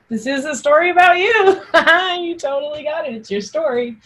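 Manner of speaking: high, amused and playful; laughing along with the joke. Real, not acted. Teacher teasing the interrupter.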